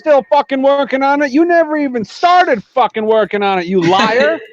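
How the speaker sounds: high-pitched mock outrage; mock angry. Teasing accusation of a friend.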